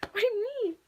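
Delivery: high, puzzled little question; surprised. Retold or imitated. After classmates thank her, a high puzzled question. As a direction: act baffled at the praise. Quoting own surprised reaction.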